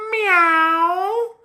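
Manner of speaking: high mewing meow; cat meow. Acted. Storyteller meowing as the cat for kids. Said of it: "animal that went".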